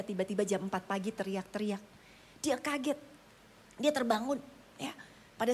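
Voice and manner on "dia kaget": normal talking voice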